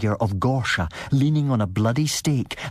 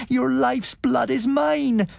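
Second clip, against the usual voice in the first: high, slow, otherworldly threat; menacing vampire cry; slower than usual. A narrator's reading. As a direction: claim his blood slowly. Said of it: "cried out".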